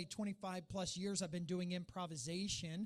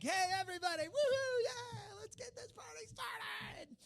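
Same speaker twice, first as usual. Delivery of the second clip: hype man. Pretend. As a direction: whoop it up like a party hype man. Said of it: "my job is to get up here and be like".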